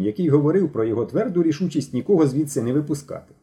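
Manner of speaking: usual speaking voice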